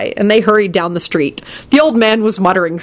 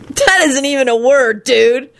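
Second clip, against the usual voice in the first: higher-pitched mild mock shriek; mildly angry kid; angry protest; 7 semitones higher than usual. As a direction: object in an annoyed, raised pitch. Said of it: "shrieked Kenny angrily".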